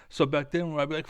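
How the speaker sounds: usual speaking voice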